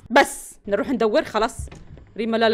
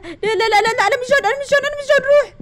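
higher and louder, desperate denial; panicked pleading; 8 semitones higher, 5 dB louder than usual. Real, not acted. Player begging the monster she is not John, panicked. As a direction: talk the monster away.

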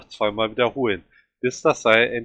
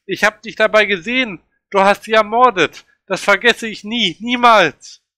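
screaming accusation; 9 semitones higher, 7 dB louder than usual. A narrator's reading. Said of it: "kann immer nur schreien".

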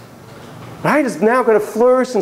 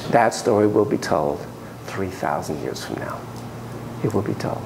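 hushed solemn; 11 semitones lower, 7 dB quieter than usual. Real, not acted. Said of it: "solemn hushed pronouncement".

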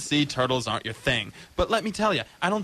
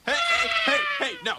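much higher, sharp warning yell; yelling stop. Acted. Shady dealer yelling at the kid reaching for his snake. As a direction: stop him grabbing the snake.

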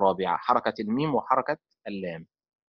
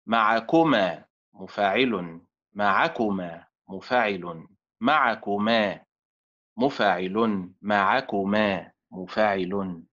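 slow, measured chanting of each word with مفاعل; metre drill; 4 semitones lower, slower than usual. Shown as a demonstration. Teacher drills students word by word, slow and measured. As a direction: beat out each word against the pattern.

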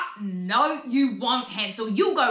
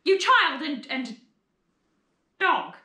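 exasperated complaining; 6 semitones higher than usual. Acted. Stepmother complains to the children.

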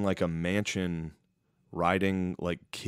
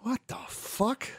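high, slow bewildered groan; baffled old dude; 4 dB quieter, slower than usual. Retold or imitated. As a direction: stretch it out, totally lost.

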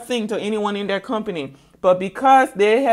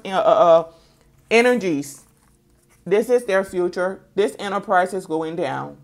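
ordinary talk, nothing marked

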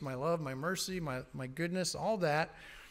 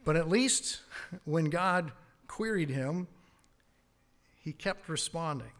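ordinary delivery

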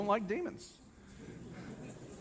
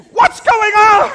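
very high, loud, drawn-out cry; panicked mock yell; 13 semitones higher, 15 dB louder, slower than usual. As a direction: yell it in bewildered alarm. Acts onlookers amid commotion.

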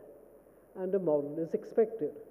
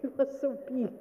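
high, drawn-up mouse admiration; lightly voiced small mouse, pitched up; mouse to lion; 5 semitones higher, 4 dB quieter than usual. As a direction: coo it in a raised little voice. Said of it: "the mouse saying to the lion".

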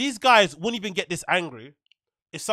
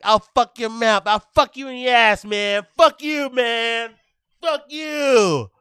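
put-on shrill-free but higher, louder raging gamer kid; Call of Duty lobby kid; 5 semitones higher, 5 dB louder, slower than usual. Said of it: "one of those kids on a Call of Duty lobby".

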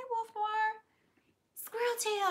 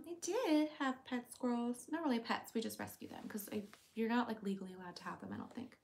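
normal talking voice

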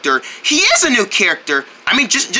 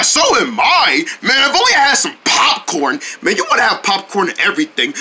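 ordinary talk

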